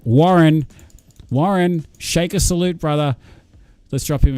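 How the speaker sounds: loud, high calling out of Warren; rally the chat to cheer him; a loud shout to celebrate a viewer; hyped shout-out. Real, not acted.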